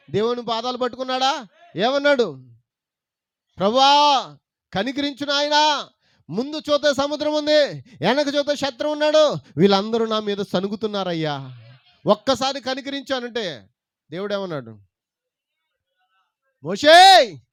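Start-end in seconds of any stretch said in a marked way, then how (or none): 3.6-13.7 Moses voiced crying out to God, raised; a loud plea for mercy; pleading Moses. Preacher voicing Moses begging God for mercy. 16.6-17.4 booming call of a name; God calling out. Retold or imitated. Preacher voicing God calling Moses loudly.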